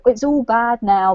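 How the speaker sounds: ordinary talk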